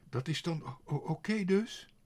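high, loud stuttering question; flustered stutter, pitch climbing; stammering. A narrator's reading. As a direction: stumble upward into a questioning finish. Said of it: "En hij hakkelde".

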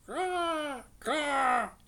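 high, loud crow caw; squawking crow call. A narrator's reading. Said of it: "en riep".

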